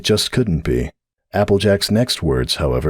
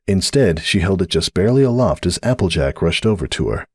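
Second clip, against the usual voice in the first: normal talking voice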